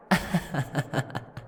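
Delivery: light, teasing laugh; short rippling laugh, trailing down; amused chuckle. Acted. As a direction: chuckle at someone's embarrassment. Amused at the other's embarrassment.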